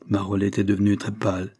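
ordinary delivery, nothing marked

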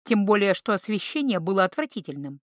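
ordinary delivery

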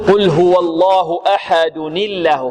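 slow chanted recitation, nun linked; Quranic recitation. Shown as a demonstration. Teacher reciting the verse to show the joined kasra. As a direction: recite slowly, linking أحدن الله. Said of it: "فنقول".

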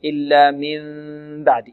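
إلا من بعد with lips left apart on the held hum; gapped lips. Shown as a demonstration. Teacher showing what readers mustn't do, gapped-lip hum. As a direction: hum من as a loose n-like sound before بعد. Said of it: "لا يقرأها القارئ هكذا".

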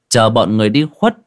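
ordinary delivery, nothing marked

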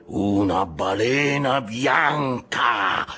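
high, drawn-out captain's cry; impassioned cry. A narrator's reading. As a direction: draw every word out in a high, slow call. Ahab cries out to the crew. (grido acuto e trascinato)

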